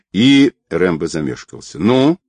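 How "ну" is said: short cry, higher and louder; raised-voice prompt, pitched up; cried out. A narrator's reading. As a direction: call it out as an impatient question. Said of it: "выкрикнула Нелли".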